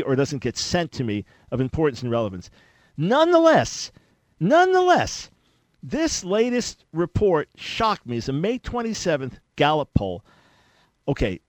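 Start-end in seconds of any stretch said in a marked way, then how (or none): 3.0-3.9 a big astonished leap up on Nonetheless; mock shocked exclaim. Host teasing listeners about a shocking poll, high loud exclaim. 4.4-8.2 nonetheless even higher, almost a squeak, then slow on shocked me; mock astonished squeal. Host teasing listeners about a shocking poll, squeaky slow astonishment.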